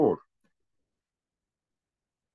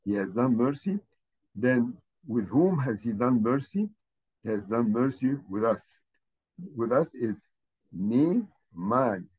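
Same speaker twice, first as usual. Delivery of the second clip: ordinary talk, nothing marked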